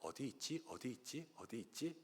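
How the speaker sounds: soft, playful "where is he" said over and over; a dad pretending he can't find the hiding child; hushed game of hide and seek; pretend searching. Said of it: "그냥 장난치는 거예요".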